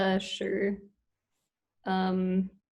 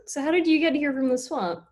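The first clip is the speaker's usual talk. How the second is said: higher and fast, keen; eager puppeteer question; 6 semitones higher, 4 dB louder, faster than usual. Shown as a demonstration. Student asks puppet alligator quickly, higher and brighter. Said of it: "let's try that again".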